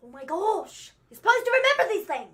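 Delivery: loud, high-pitched exasperation; exasperated outburst. Real, not acted. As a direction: scold them loudly for forgetting. Exasperated at forgotten trivia.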